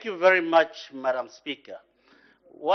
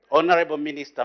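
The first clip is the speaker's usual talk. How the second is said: usual speaking voice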